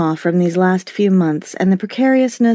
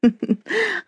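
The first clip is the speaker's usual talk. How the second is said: high light tinkling laugh; a quick tinkling giggle; 11 semitones higher, 4 dB quieter than usual. Acted. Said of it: "Cass's laughter tinkled in her ear".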